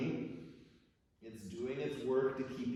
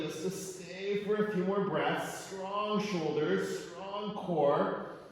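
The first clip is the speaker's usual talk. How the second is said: slow, higher, louder cues held through effort; strained yoga cues; 7 semitones higher, 4 dB louder, much slower than usual. Real, not acted. Teacher cueing students while holding a headstand, strained calls. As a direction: coach the class while straining upside down.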